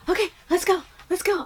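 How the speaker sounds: hyper brain voice. Retold or imitated. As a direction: play the restless brain egging her on. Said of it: "And you're like".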